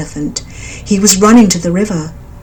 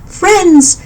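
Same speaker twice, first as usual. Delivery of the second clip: high, loud call to the forest; 11 semitones higher, 4 dB louder than usual. A narrator's reading. Mother bird calling the birds, high and loud. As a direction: summon your friends from far off. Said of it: "she called out".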